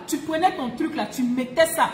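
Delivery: normal talking voice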